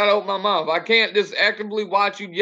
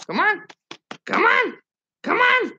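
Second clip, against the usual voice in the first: high-pitched dog call; 12 semitones higher than usual. Real, not acted. Calling the dog again.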